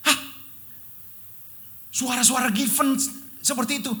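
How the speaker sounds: high, loud disbelief; shocked realization. Real, not acted. Preacher telling the church of the shock of hearing his daughter's voice. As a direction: gasp it out in astonishment.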